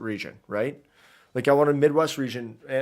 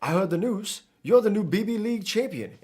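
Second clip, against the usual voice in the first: higher, lilting attempt at a Creole accent; animated Creole-accented character, pitched up; Cyrano accent; 7 semitones higher than usual. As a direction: voice a game character in a put-on New Orleans accent. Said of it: "pull out the Cyrano accent".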